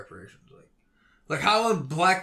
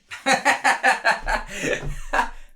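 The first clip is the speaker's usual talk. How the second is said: laughing at himself; 7 semitones higher than usual. Real, not acted. Laughs after admitting his rant.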